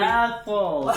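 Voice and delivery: tongue held out. Pretend. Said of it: "You're not holding your tongue".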